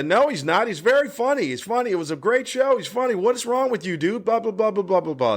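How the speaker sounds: high, excited bros defending him; mock young guys shouting, pitched high; young guys chiming in. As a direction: pitch up as rowdy young fans.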